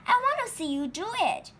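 high and quick, eager; high, fast eager plea; excited. Acted. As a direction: rush it out like an impatient child.

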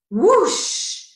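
loud, high gusty whoosh; whooshing wind sound. A narrator's reading. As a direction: let it blow out like a sudden gust. Story wind sound acted.

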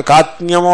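కాత్న్యము with no r and no s; faulty కాత్న్యము, only t-n-y left; r dropped. Shown as a demonstration. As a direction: say కాత్న్యము as kaat-nyamu. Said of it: "రా పోయింది".

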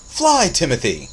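big, loud, high-pitched command; booming shout. A narrator's reading. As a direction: shout it out to launch the boy. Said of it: "shouted Einar".

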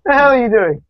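sharp high call at an intruder. Real, not acted. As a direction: snap at someone barging in. Shouting at someone in the room.

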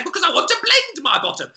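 high, fast, offended squeal; high indignant customer. Retold or imitated. Customer defends her odd test to staff, high and huffy.

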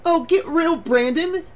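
high-pitched, exasperated shriek. A narrator's reading. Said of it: "Julie shrieked".

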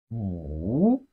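"wu" dipping very low, then swooping up; falling-rising tone. Shown as a demonstration. Teacher models Chinese five for learners, dipping-rising "wu". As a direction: draw out "wu" from a low dip to a rise. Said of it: "It has the falling rising tone".

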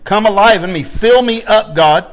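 loud, fervent revival plea; preacher pleading at full voice; crying out in prayer. Acted. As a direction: call out to God with rising force. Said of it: "You need to cry out".

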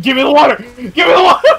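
high, loud parody chant breaking into laughter; mock desperate shout. Parodying Give Me the Loot, mock desperate shouting. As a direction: shout it like a hammed-up song hook. Parodies song as frantic demand.

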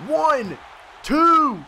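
high, loud referee-style count; excited pin count. Real, not acted. Player counting along with the pin, excited. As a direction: call out each number hard.

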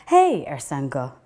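ordinary delivery

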